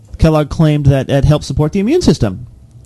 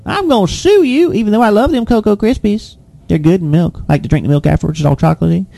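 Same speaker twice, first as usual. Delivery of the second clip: high whiny customer threatening to sue; squeaky complaining customer, softening at end; 8 semitones higher than usual. Pretend. Angry customer mocked.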